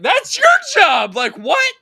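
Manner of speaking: loud, high, that's your job; husband protesting to the doctor, loud and high; indignant yell. Retold or imitated. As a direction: shout it in disbelief. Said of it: "And I'm like".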